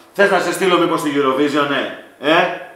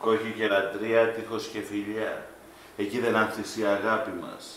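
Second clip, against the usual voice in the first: soft, hushed romantic murmur; tender; 5 semitones lower, 12 dB quieter than usual. Acted. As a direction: coax her gently. Husband sweet-talking upset wife. (τρυφερό, πολύ σιγανό ψιθύρισμα στη γυναίκα του)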